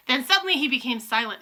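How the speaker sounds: ordinary delivery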